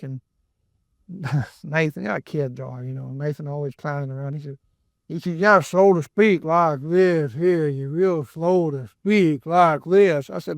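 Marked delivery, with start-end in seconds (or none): 5.4-10.3 drawn-out, very slow mock drawl; mock slow talking. Son clowning around during devotions, dragging it out very slowly. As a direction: stretch every word like a kid clowning. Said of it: "No, that's not slow".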